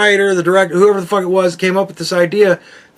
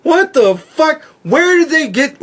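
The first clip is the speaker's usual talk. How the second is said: high, loud, rising what the fuck; high, louder indignant disbelief; outraged disbelief; 11 semitones higher, 3 dB louder than usual. Retold or imitated. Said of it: "I'm like".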